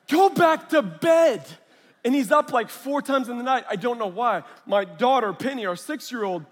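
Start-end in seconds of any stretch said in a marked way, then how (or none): 0.1-1.4 slow, high, very loud order; exasperated parental shout. Retold or imitated. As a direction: bellow it out word by word. Parent orders child to bed.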